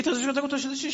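high, laughing retort of the Salafi; laughing quote. Retold or imitated. Student retelling the Salafi driver's reply, laughing. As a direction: laugh through the question.

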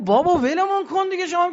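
exasperated retort. Retold or imitated. Wives retort to the Prophet.

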